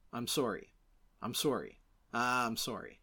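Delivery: low Canadian "sore-ee" apology; Canadian accent. Retold or imitated. Podcaster apologizes repeatedly, imitating a Canadian accent. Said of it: "how pronounced Michael J. Fox's Canadian accent was".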